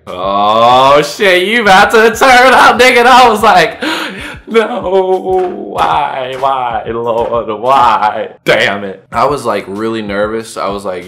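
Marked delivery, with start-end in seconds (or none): 0.1-3.0 rising into a high, loud hype yell; teammates hyping up. Acted. Teammates egging him on after the drink, loud excited hype. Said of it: "all my teammates were looking at me like". 4.5-9.1 mock despair. Drinker realising he downed it, melodramatic wail.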